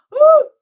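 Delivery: sharp high exhale to shake off the laughing; loud high whoop. Real, not acted. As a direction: let out a loud high woo. Shaking off the laughter.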